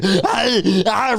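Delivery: exaggerated straining running grunts; mock heavy huffs, knees pumping; Arnold-style huffing. Host imitates sheriff sprinting through foliage, Schwarzenegger-like grunting breath. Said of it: "like, Arnold Schwarzenegger in his breath".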